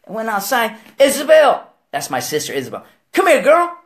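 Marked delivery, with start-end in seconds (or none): 1.0-1.7 high, loud call for someone outside. Acted. Brother yelling outside for his sister, calling out. 3.1-3.8 loud, high-pitched holler; summoning call. Acted. Brother calls his sister over, loud summons.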